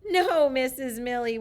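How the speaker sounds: mild playful shout; light shout. Retold or imitated. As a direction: call out to the teacher together. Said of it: "we shout".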